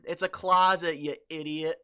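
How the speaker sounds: loud mock scolding. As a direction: scold like an impatient know-it-all. Scolding imagined Brit.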